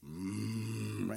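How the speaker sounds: low, soft monster drone; quiet creepy "mmm" rumble; low eerie hum. Retold or imitated. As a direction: hum a long, low menacing note. Dad spooking kids in the dark.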